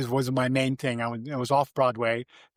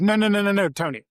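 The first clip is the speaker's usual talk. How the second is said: eager protest; 7 semitones higher, 5 dB louder, faster than usual. Retold or imitated. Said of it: "I just saying".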